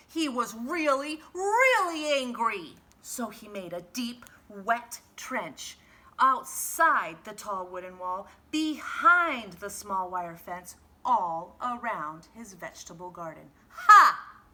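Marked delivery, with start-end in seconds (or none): 0.1-2.8 louder and higher narration, slowing on angry; angry build. A narrator's reading. 13.7-14.4 loud high triumphant snort. Acted. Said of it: "he snorted".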